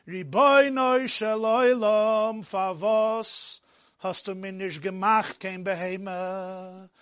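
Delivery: very slow, drawn-out mock wail in Yiddish; imitated sobbing lament. Boy cries out to the Master of the universe, imitated lament. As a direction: sink gradually into a plaintive singsong plea. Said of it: "He starts sobbing and er sagt".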